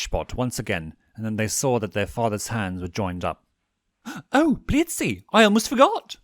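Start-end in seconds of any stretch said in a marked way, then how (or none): 4.0-6.2 high, loud excited cry; sudden excitement. A narrator's reading. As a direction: burst out, remembering. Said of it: "the owl said suddenly".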